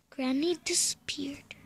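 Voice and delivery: soft puzzled whisper; hushed wonder. Real, not acted. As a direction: whisper it while searching.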